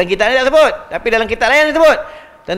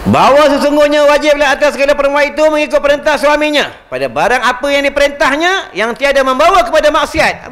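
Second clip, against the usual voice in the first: kitab reading; 7 semitones higher than usual. Acted. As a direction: chant the classical kitab line like a formal reading. Reads classical kitab text aloud. (bacaan kitab dengan nada tinggi dan lantang)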